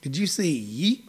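quiet, puzzled Southern judge; a quiet, courtly Southern judge. Retold or imitated. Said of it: "He goes".

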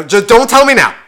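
loud, irritated cut-off; annoyed snap. Retold or imitated. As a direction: bark it at the chatty driver. Snapping at radio-volume back-seat driver.

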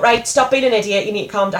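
inner shouting voice. Retold or imitated. Scolding oneself after a car crash, mildly shouting. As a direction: pull yourself together, mildly shouted. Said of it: "like, the shouting voice going".

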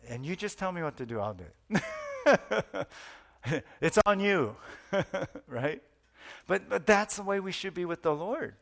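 ordinary delivery

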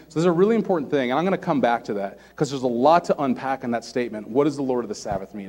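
normal talking voice

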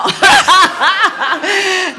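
long, loud, high laugh. Real, not acted. Laughing loudly as she won't say the rest. Refuses to tell the ending.

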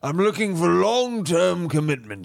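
affected upper-crust host voice; grand rich-man manner; pompous rich bachelor. Acted. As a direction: talk like a self-important millionaire.

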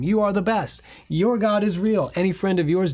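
loud, high effusive praise; lavish praise like a grateful king; gushing king. Retold or imitated. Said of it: "Nebuchadnezzar says".

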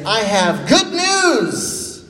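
slow, drawn-out herald proclamation; herald's call. Shown as a demonstration. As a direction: announce it like a town herald, slowly. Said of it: "Can you be a yeller".

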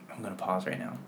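hushed. Acted. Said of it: "enjoy my whispered voice in your ear".

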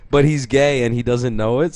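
ordinary talk

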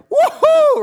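very high, loud whoop; acted jubilant "woohoo"; celebrating cheer. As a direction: whoop like a congregation seeing a miracle. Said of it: "this would be like".

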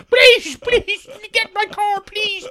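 very high, loud wheedling plea; shrill high pleading creep; desperate begging. Acted. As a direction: beg in a squeaky pathetic voice. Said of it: "you're driving around the playground".

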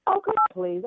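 high-pitched, exasperated brush-off; retold dismissive protest. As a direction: act out waving the idea away.

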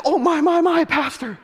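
high, loud, scandalized exclamation; mock shock. Norma sees naked Harold and tells pastor, mock shock.

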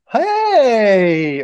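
a high, loud hey with a tone behind it; loaded greeting. Shown as a demonstration. Improv warm-up greeting said with a pointed tone. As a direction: throw a charged hey. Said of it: "they have a certain tone behind it".

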